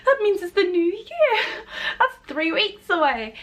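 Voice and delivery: high, dramatic shocked squeal, stretched out slowly; mock shocked disbelief. Retelling her reaction to the mentor, squealing in mock shock. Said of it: "I was like".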